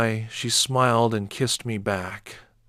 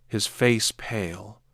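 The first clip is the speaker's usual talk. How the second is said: plain reading voice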